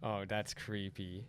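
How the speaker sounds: low, slow, soft mock creeped-out voice; teasing a friend's creeped-out reaction; imitating his friend. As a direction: drag it out spooked. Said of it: "when you were like".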